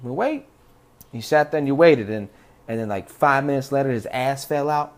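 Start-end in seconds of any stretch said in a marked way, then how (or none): 0.0-0.5 higher, wary go-along voice; skeptical tribe. Retold or imitated. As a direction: humor him with a raised doubtful voice. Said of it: "we're like".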